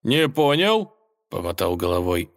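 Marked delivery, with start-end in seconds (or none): 0.0-0.9 puzzled rising question; недоумение. Acted. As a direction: ask it baffled, pitch up. Лже-Иван переспрашивает. (недоумённо)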